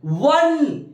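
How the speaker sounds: वन sung out high and drawn, with a clear व at the start; pronunciation demo. Shown as a demonstration. Teacher to students, modelling the word वन. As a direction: model the word वन as a sound to copy. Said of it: "वन पढ़ रहे हैं".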